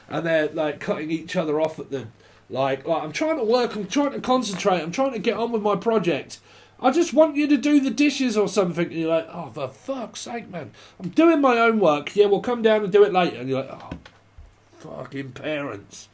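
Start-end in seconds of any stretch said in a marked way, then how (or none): 3.0-8.9 high, harried complaint; hammed-up exasperated pleading; mock pleading. Acts household quarrel. 9.3-10.7 slow, hushed mock groan; acted under-the-breath exasperation; exasperated. Acts child's exasperation. 11.0-13.4 loud, high-pitched mock protest; hammed-up household bickering; irritated quarrel. Acts quarrel over dishes. 13.7-16.1 slow, soft mock grumble; acted sulky mutter; resentful muttering. Acts child grumbling about parents.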